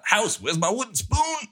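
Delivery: high put-on voice calling to the house; imitating Goldman. Host recalls a fellow fan's bit, high silly voice. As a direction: squeak it out as a voice command to the house. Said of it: "Goldman, remember".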